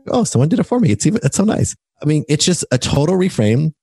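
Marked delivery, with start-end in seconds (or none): 0.1-1.7 high, pleased little gasp of thanks; the husband, thrilled someone did it; delighted surprise. Retold or imitated. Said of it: "I was like".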